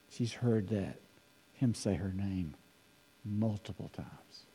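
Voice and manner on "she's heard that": normal talking voice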